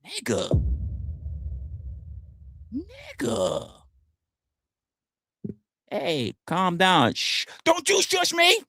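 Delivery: high, soft, slow mocking plea; mock exasperation. Streamer acting out a chatter telling him to calm down. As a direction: act exasperated and dismissive.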